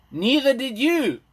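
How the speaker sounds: high, loud, slow call-out from across the hall; heckle from the back. Retold or imitated. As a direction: shout it back across the room. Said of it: "from the back of the hall came".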